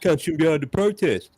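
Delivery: high whining commuter; mock whiner. Mocking a driver stuck on the bridge, high and whiny. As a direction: complain like an annoyed driver.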